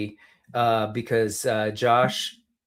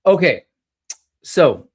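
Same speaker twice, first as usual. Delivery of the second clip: normal talking voice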